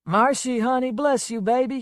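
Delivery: a lightly voiced boozy Southern showman; a hint of a drunk country star, fed up; lightly played drunk blowhard; Lonesome's voice. A narrator's reading.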